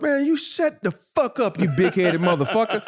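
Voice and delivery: high, heated mock yell; retold locker-room rage. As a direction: fire back at the teammate. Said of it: "I said".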